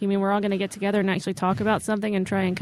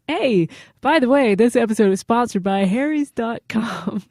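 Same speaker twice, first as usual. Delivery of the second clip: bright louder sponsor pitch; ad read; 2 semitones higher, 4 dB louder than usual. Acted. Cohost switching to the sponsor read, hammed-up announcer voice. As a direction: pivot into the ad like an announcer. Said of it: "great segue".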